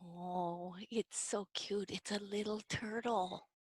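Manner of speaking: very soft, tender coo; hushed adoring. Pretend. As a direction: coo softly over a tiny animal. Hushed reveal of little turtle.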